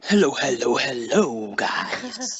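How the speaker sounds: low, sinking Dominican-style greeting; put-on Dominican accent. Caller greeting the show's hosts, put-on Dominican accent. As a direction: attempt a Dominican accent, voice dropping away. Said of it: "Are you trying to be a Dominican".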